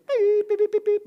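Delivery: very high, loud bleeps; long loud beep, then a quick string of beeps; imitated censor beep. Bleeping out people's swearing for the group, imitated beeps.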